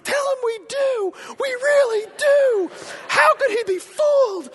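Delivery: put-on high, slow child shout; eager kids. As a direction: shout it like an overexcited kid. Jonathan voiced as a child.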